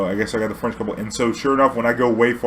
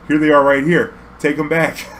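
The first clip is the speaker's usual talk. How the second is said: louder, pushing back; exasperated; 5 semitones higher, 6 dB louder than usual. Retold or imitated. Driver telling the passenger to take his pills back, exasperated and loud. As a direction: shove the pills back at him.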